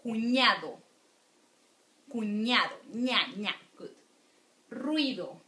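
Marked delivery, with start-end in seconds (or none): 0.0-2.7 cuñado with a clear ny sound on ñ; model the ñ. Shown as a demonstration. Teacher to students, modeling the ñ sound. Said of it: "because the ñ, it's really important". 2.9-3.5 ña, ña with a clear ny onset; ñ drill. Shown as a demonstration. Teacher to students, drilling the ñ syllable. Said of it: "the ñ, it's really important".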